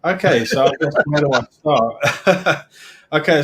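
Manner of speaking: laughing his way into the start. Real, not acted. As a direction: chuckle through it, a little embarrassed. Laughing as he begins his story.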